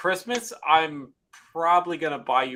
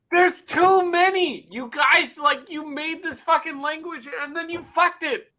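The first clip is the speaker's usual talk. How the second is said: exasperated; 11 semitones higher than usual. Real, not acted. Exasperated rant about slang.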